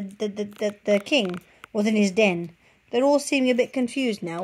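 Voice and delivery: ordinary delivery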